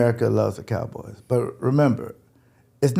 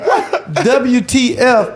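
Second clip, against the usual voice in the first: shocked disbelief; 9 semitones higher, 5 dB louder, much slower than usual. Retold or imitated. Girlfriend shocked at the cold.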